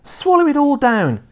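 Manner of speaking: high, eager call to swallow; a boy urging, high and excited; eager cry. A narrator's reading. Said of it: "George cried out".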